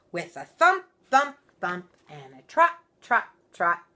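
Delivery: loud, punchy stomping rhythm. A narrator's reading. Narrator stomping out the bears' footsteps, loud. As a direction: stamp each word like heavy steps.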